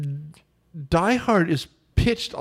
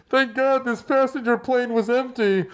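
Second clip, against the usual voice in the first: high, hammed-up relieved exclamation; mock relief; 7 semitones higher than usual. Imitating a line added after poor test screenings, mock relief. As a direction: play obvious dubbed-in relief, pitched high. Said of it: "they were just like".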